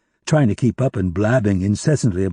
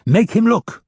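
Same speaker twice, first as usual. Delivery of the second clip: higher, sharp order to his men; commanding; 11 semitones higher, 3 dB louder, slower than usual. Acted. Captor orders his men to hold the prisoner, loud command. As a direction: bark the command. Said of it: "Roddy commanded".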